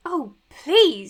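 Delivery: loud, exasperated objection; exasperated protest. Acted. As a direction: cut in, scandalised by the question. Friend objects to personal question.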